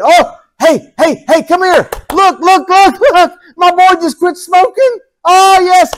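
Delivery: a father who can't contain his pride; excited calling out. Acted. God calls everyone to look.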